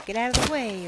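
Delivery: imitating a New Yorker; mock New York. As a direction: shoo someone off, New York style.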